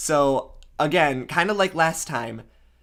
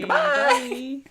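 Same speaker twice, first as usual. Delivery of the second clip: very high, drawn-out farewell; playful squeaky goodbye. Pretend. Host signing off to listeners, playful very high goodbye. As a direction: sign off way up in falsetto.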